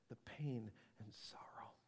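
hushed and heavy; whispered sorrow. Real, not acted. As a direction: breathe the pain and sorrow out.

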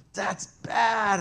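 put-on screamed at kid. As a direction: snap it at the kid like a scold. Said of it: "and I screamed at this kid".

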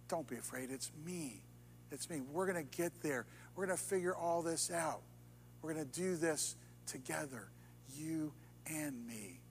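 soft, tender voice of God comforting; reassuring, hushed. Pretend. God reassuring a frightened believer, softly and tenderly. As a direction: speak low and gentle, like a promise.

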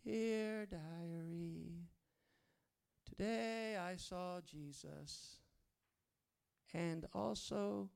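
slow, drawn-out mock diary voice; mock diary entry. Preacher mocking a shallow believer's diary, slow. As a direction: read it like a naive diary. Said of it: "you get your diary, and you say".

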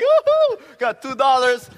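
loud, high whoop of delight; joyful whoop. Retold or imitated. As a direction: burst out cheering over the money. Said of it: "I was so joyful, like, happy".

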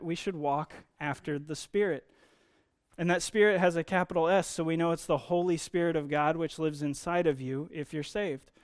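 ordinary talk, nothing marked

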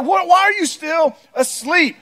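high, drawn-out disbelief at a sleepyhead; exasperated parent. Acted. Parent waking kids for church, exasperated.

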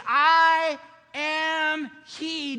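slow, loud and high, every word weighted; booming, drawn-out "I am he"; divine declaration. Acted. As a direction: proclaim it like the voice of God. God declares himself in Isaiah.

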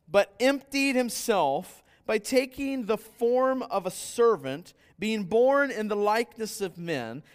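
slow, high, loud solemn reading; scripture reading. Acted. Preacher reading Philippians to the congregation. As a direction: proclaim the verses with weight.

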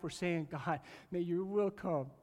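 usual speaking voice

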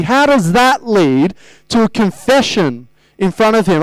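ordinary talk

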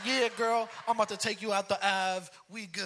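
loud, swaggering reply; proud boast. Retold or imitated. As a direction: brag to your wife. Boasting to wife.

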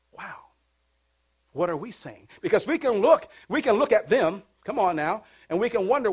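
ordinary delivery

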